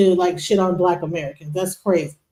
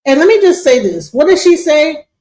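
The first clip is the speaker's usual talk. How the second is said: loud and worked up; 4 semitones higher, 6 dB louder than usual. Real, not acted. S1 reacts loudly, quoting her.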